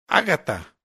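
respuesta brusca y cortante, más alta; suéltalo seco, de golpe. A narrator's reading. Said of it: "fue la brusca respuesta". (brusco y cortante)

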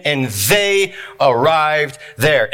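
"they" stretched out slowly and set apart; stress on they. Shown as a demonstration. Preacher reading scripture to the church, stressing "they". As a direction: lean hard and long on the word "they". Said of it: "Emphasis on the word they".

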